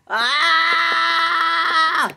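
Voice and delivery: high, sustained scream of frustration; long scream. Acted. Boss losing it as customers leave, screaming. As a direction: scream it out and hold it.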